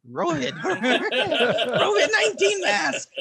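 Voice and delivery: laughing through it, high and loud. Real, not acted. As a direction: crack up mid-word. Laughing at wordplay.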